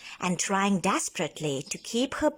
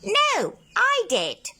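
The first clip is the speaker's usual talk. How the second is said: much higher, louder octopus voice; booming octopus; 5 dB louder, slower than usual. A narrator's reading. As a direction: boom it back at him. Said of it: "the voice boomed again".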